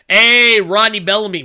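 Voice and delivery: big loud shout-out to a viewer; hyped welcome to a chat donor; loud greeting. Real, not acted. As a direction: call the name out with energy.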